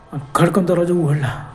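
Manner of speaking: plain reading voice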